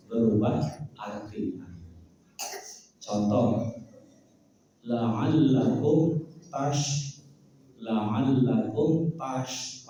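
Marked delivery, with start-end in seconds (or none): 4.8-9.9 "la'allakum tash-" dieja pelan; pelan dan mengeja. Shown as a demonstration. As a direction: ucapkan pelan seperti guru mengaji. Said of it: "pakai shin ya". ("tash-" dieja perlahan)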